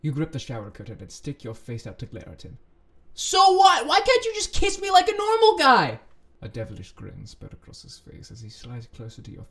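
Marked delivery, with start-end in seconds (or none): 3.2-6.0 very high, loud whining yell; shouted protest. Acted. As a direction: wail it in frustration. Acted quarrel in the story.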